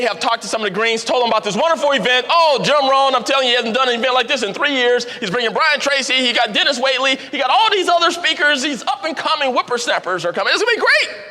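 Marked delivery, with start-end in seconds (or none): 2.3-11.2 gushing invitation, pitch climbing; eager pitch. Retold or imitated. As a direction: sell the event to a friend with growing excitement. Pitching event to a green friend.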